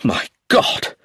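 a burst on GOD; stunned outcry; shocked exclamation. A narrator's reading. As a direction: exclaim in disbelief. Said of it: "exclaimed Roger".

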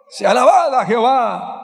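loud, high sermon voice; preaching at full force. Acted. Preacher exhorting the congregation, loud and high. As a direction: drive the point home.

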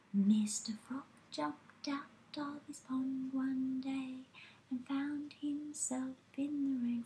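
whispered, soft singing; piano soft. Shown as a demonstration. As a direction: sing it hushed, almost whispering. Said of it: "let's sing this piano, piano soft".

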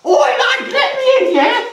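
high-pitched, shouted demand at the door; mock high-pitched shout from a caller; shouting bloke. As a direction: yell it high, as a pushy bloke outside. Acting out bloke demanding entry.

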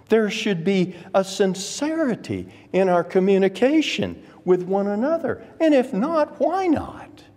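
high-pitched pulpit challenge; impassioned preaching. Acted.

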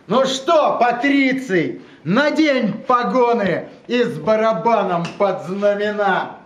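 declaiming a poem to a live audience; dramatic poetry declamation. Acted. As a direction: recite the verse with weight and rhythm. Poet reads own poem aloud.

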